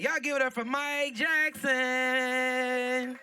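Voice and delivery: big drawn-out stage introduction; announcer intro. Real, not acted. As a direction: hype him up and stretch the name. Introducing the comedian again.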